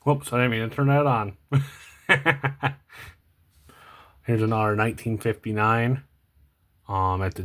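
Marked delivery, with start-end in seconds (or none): 2.1-2.7 short, high chuckle; light laugh. Real, not acted. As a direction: laugh off your own little accident. Laughs at accidentally switching something on.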